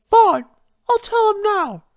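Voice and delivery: fine, sighed in defeat; sighing Rarity. Acted. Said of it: "Rarity sighed".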